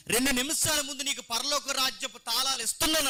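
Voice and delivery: high, ringing proclamation; exalting praise. Acted. Jesus promising Peter the keys, praising him highly. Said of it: "అక్కడెందుకు పొగిడాడు".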